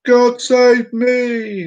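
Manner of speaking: high, loud, very drawn-out call; jumper's cry. Acted. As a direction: holler it slowly as you leap. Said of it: "Englishman jumped out and he went".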